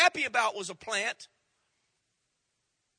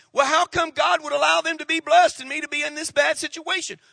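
mock indignant complaint; 7 semitones higher, 6 dB louder than usual. Voicing a complainer.

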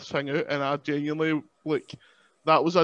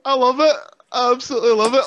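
delighted; 8 semitones higher, 8 dB louder, faster than usual. Real, not acted. Delighted after laughing.

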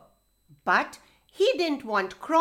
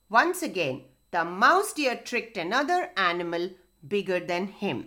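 ordinary delivery

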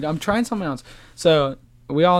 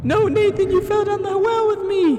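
very high, loud squeak; squeaky high voice; 4 dB louder than usual. Pretend. High voice in the well.

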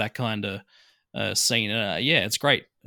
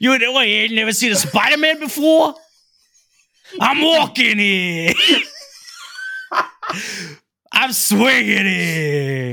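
higher, hammed-up Brooklyn tough guy, laughing through it; big Brooklyn accent; 6 semitones higher than usual. Podcaster mocks Spider-Man's Brooklyn act, hammed-up Brooklyn accent. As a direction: overplay a New York cabbie yelling. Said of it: "he puts on that, uh, Brooklyn accent".

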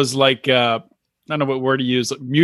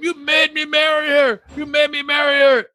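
crying and yelling; 10 semitones higher than usual. Retold or imitated. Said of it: "crying and yelling at them".

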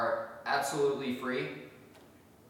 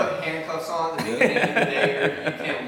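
laughing; 3 semitones higher, 9 dB louder, faster than usual. Real, not acted. Teasing guest about partnership.

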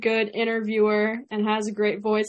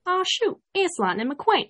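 Larry the Cable Guy; 8 semitones higher than usual. Shown as a demonstration. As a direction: demonstrate the folksy country accent. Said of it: "repeat everything Larry the Cable Guy says".

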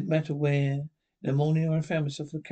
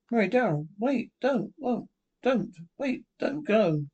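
much higher, urgent cries of don't; begging him desperately to wait; frantic pleading; 6 semitones higher than usual. Acted. Mary pleading with Darrell not to go, frantic.

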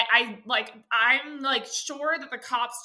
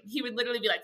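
usual speaking voice

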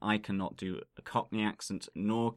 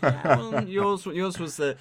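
laughing; 9 semitones higher, 7 dB louder than usual. Real, not acted. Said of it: "laughing while teasing co-host".

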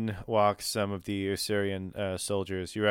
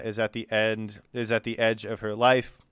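normal talking voice

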